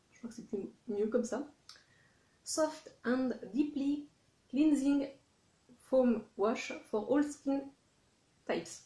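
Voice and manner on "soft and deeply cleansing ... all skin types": French-accented English read aloud. Real, not acted. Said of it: "parler en anglais parce que c'est une catastrophe".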